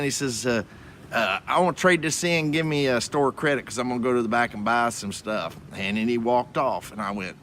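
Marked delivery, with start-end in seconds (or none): none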